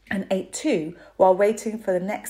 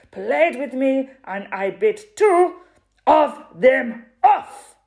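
shouted, higher boastful strongwoman; loud and strict; 4 semitones higher, 6 dB louder than usual. A narrator's reading. Strongwoman boasting to the crowd about her teeth, shouting. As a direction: boom every sentence like a shout. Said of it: "She spoke very loudly. Every sentence was a shout".

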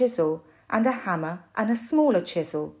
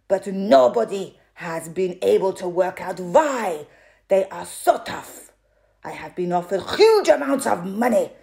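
loud boastful strongwoman; loud and proud; 5 dB louder than usual. A narrator's reading. Strongwoman boasting to the audience, loud. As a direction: declaim it like a shout. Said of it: "Every sentence was a shout".

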